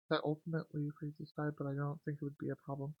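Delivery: ordinary delivery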